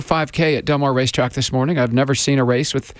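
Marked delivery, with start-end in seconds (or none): none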